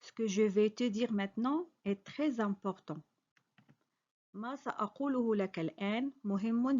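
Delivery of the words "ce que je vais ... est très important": normal talking voice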